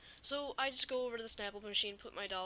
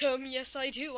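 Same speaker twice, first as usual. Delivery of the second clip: loud, cheeky, higher retort; defiant reply; 4 semitones higher, 8 dB louder than usual. Retold or imitated. Student loudly and cheekily defying the strict teacher. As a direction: talk back with cheek. Defiant kid answering again.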